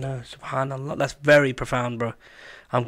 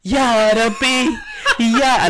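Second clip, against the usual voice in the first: slow, high laugh-filled cry; laughing exclamation; 11 semitones higher, 6 dB louder, much slower than usual. Real, not acted. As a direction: drag it out while laughing.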